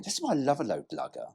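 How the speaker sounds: ordinary talk, nothing marked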